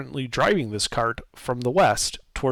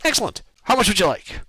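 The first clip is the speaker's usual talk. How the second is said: higher, eager little merchant voice; gnome merchant; 6 semitones higher, 3 dB louder, faster than usual. Acted. Gnome merchant asking the guard how much, eagerly higher. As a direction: sound pleased at the sale.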